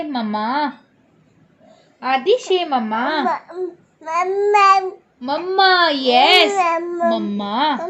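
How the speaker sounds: high sing-song coaxing. Real, not acted. Coaxing baby to say mamma. (बच्चे को मम्मा बोलने के लिए मनाना)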